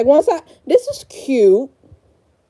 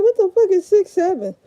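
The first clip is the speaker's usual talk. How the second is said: higher, put-on bewildered quoting; mock baffled self; 8 semitones higher, faster than usual. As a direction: replay your own confused question, pitched up. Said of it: "I'm like".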